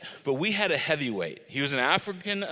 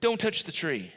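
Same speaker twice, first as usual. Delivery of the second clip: pitched-up generous pitch, then a firm condition; expansive offer; 8 semitones higher than usual. Retold or imitated. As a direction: sell paradise with rising enthusiasm. Voices God's generous offer in Eden.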